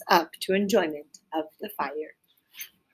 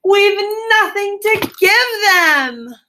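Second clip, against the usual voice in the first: much higher, slow despairing wail; cry of despair; 12 semitones higher, 6 dB louder, slower than usual. Acted. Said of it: "he cried in despair".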